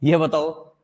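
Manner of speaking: ordinary delivery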